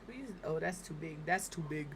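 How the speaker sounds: ordinary talk, nothing marked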